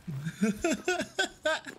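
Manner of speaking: high giggling laugh; laughing fit. Real, not acted. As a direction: crack up in high, quick bursts. Streamer cracking up mid-game.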